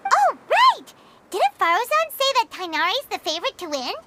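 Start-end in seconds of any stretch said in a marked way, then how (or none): 0.1-0.9 bright, loud, high pop of remembering; sudden realization. Acted. Paimon suddenly recalling whom to visit, excited. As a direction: snap out of thinking with an eager jolt.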